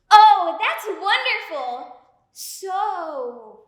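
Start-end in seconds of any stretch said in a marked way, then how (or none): 0.1-2.0 slow, loud, gushing fake delight; overly bright salesy. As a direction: gush like a cheesy infomercial host. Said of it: "You sound like a cheesy infomercial".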